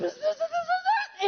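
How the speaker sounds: high-pitched buzzing bursts, half laughing, half crying. Real, not acted. Overcome by the Spirit at the dinner table, high buzzing cry. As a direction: let a high buzz break out of you, laughing and sobbing at once.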